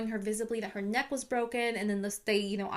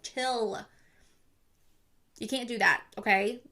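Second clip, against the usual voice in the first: ordinary talk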